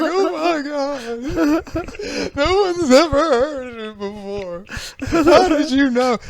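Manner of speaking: fake-sob over a rare album; hammed-up crying thanks; mock tearful gushing. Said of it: "they're, like, crying".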